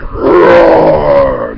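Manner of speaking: loud imitated roar mid-story; lion roar. A narrator's reading. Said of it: "And when he roared".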